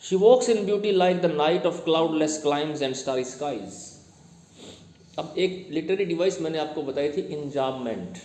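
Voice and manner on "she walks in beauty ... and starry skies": slow, measured poem reading; poem recitation. Acted. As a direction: read the verse out slowly, word by word. Reads the poem line aloud.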